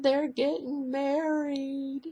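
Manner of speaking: high, slow, tearful confession; imitating crying daughter. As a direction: let the daughter's news come out slow and high through tears. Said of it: "and she was crying".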